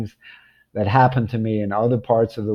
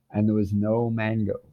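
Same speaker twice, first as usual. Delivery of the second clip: usual speaking voice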